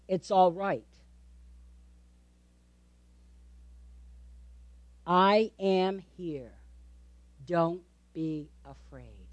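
slow, low, soft reassurance; calm Jesus. Acted. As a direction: soothe gently, leaving space between words. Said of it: "calmness in his voice".

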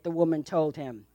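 normal talking voice

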